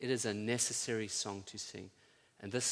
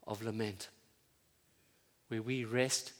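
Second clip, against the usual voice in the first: ordinary talk, nothing marked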